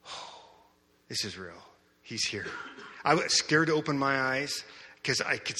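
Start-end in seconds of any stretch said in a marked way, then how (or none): 0.1-2.5 hushed awe. Retold or imitated. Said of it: "And I'm like".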